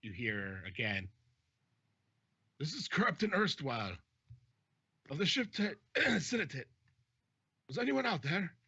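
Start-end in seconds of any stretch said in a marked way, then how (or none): 2.6-8.5 high-pitched hail across a distance; captain yelling afar. Acted. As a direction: announce yourself to anyone out of sight. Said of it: "probably yelling over quite a distance".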